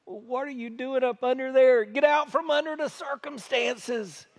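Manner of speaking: jumps up high with a teasing challenge; playful retort. Retold or imitated. Pastor telling a gloomy friend to get out, playful and lively. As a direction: poke fun to shake them out of it. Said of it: "And I always wanna say".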